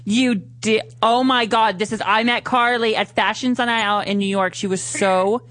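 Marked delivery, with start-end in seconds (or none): none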